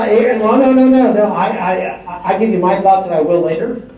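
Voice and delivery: ordinary talk, nothing marked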